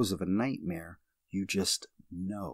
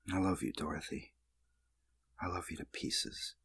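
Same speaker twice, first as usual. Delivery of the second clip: tender whisper; 8 dB quieter than usual. Acted. Tender diary confession to Dorothy.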